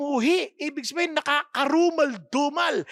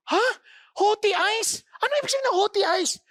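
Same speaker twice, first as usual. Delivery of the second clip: puzzled; 8 semitones higher, faster than usual. Pretend. Mock puzzled listener.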